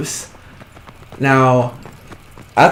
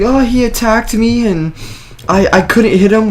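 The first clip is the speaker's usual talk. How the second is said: whiny plea; 8 semitones higher than usual. Retold or imitated. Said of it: "I'm like".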